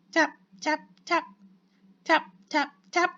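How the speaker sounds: high, staccato knocking imitation; tapping sound. A narrator's reading. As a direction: say each tap as a short high knock.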